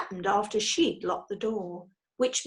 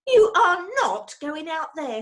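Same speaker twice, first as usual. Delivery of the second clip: Missus Brown's voice, higher and louder, scolding; a wife forbidding her husband, raised and sharp; stern wife; 7 semitones higher, 8 dB louder than usual. A narrator's reading. Wife stopping husband from climbing out, alarmed scold. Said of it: "said Missus Brown".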